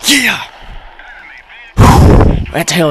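loud, high triumphant yell; victory shout. Real, not acted. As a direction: celebrate the big win. Gamer wins the match.

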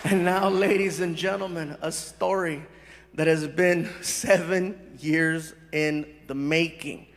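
low, measured announcer delivery; formal stage introduction. Real, not acted. Comedian introduces the other side of a story, solemnly. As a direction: build suspense with deliberate pauses.

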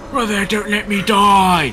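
loud, high, drawn-out plea; slow, high holler for help; desperate shout. Real, not acted. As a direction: yell for your teammate to save you. Downed player pleading to be revived.

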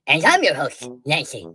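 Nancy said low; second host. Acted. Second host introducing herself, low pitched Nancy. Two hosts both named Nancy.

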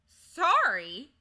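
high, very loud outburst; indignant exclamation. A narrator's reading. Digory told to say sorry, exclaiming. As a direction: exclaim it in outraged disbelief. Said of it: "exclaimed Diggory".

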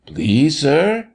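ordinary delivery, nothing marked

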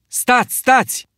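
very high, loud cry; urgent shout to stop them. A narrator's reading. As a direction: yell to halt the boys.

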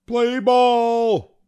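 high, loud umpire's call; umpire's shout. A narrator's reading. Umpire starting the game, shouting. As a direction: holler it out like an umpire. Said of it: "shouted the umpire".

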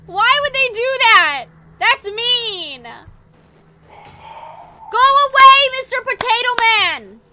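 high, loud rattled whining; real flustered high whine; shaken whine. Real, not acted. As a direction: still shaken from the scare. Shaken after attack, whining apology.

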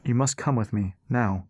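understated solemn insistence; grave. A narrator's reading. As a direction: insist seriously, dropping on the last word. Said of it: "His tone turned grave".

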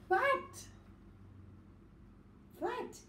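high, excited Fat. Shown as a demonstration. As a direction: say Fat. high to grab the kids.

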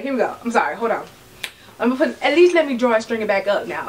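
normal talking voice

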